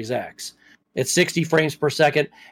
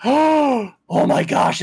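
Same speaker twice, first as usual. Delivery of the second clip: gasped surprise; 7 semitones higher, much slower than usual. Retold or imitated. Wife gasps recognizing the song.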